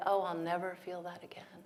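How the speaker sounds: hushed, mock-despairing murmur; a touch of hopelessness, very soft; mock forlorn. Voices despair when lover leaves.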